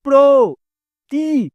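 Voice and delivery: syllables stretched. Shown as a demonstration. Teacher demonstrating open syllables, very slowly drawn out. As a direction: drag out প্র, তি, ভা one by one. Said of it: "তিনটাই আমি টেনে টেনে লম্বা করছি".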